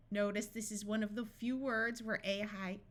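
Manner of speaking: usual speaking voice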